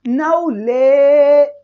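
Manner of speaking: slow drawn नॉलेज; slow pronunciation demo. Shown as a demonstration. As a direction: drag नॉलेज out slowly. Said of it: "देखो नॉलेज".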